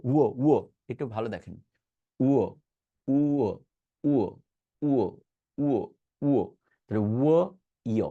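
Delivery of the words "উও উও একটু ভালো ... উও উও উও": "উও" as a rounded w glide; w sound. Shown as a demonstration. Teacher drilling students on the w sound. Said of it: "উচ্চারণ করবেন উও".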